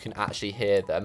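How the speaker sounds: usual speaking voice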